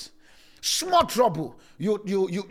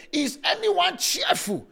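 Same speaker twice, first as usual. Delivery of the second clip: emphatic call; 8 semitones higher, slower than usual. Acted. Preacher reading scripture emphatically.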